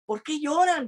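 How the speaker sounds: high, aching question to weeping friends; pained question. Retold or imitated. Pablo asking his weeping friends why they cry, pained.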